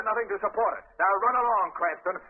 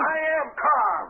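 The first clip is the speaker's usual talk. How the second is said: snapped, higher and louder, slow and deliberate; angry denial; 7 semitones higher, 4 dB louder, slower than usual. Acted. Commissioner snaps at Cranston that he is calm, angrily. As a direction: bite off each word to insist you're calm.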